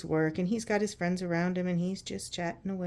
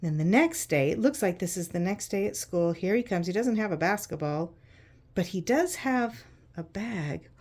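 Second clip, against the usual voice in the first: ordinary delivery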